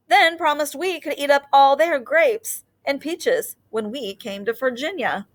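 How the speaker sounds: put-on gentle storybook read-aloud for young listeners; teacher reading a picture book to her class; reading to children. A narrator's reading. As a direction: read it like story time on the rug.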